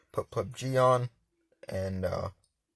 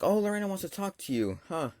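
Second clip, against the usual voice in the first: higher-pitched imitation of mom; mock casual mom; 8 semitones higher than usual. Mom passing over the phone.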